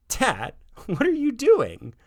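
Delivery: put-on high kid voice laughing through the question; boy cracking up at his father's robot act. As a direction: giggle out the words, amused by dad. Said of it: "Josh was laughing as he asked".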